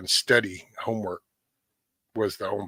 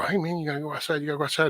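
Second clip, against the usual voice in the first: higher-pitched mock coaxing; lightly acted dog owner; 6 semitones higher, much faster than usual. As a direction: lightly imitate urging a dog out. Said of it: "walk in there and go".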